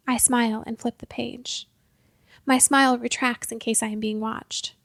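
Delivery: plain reading voice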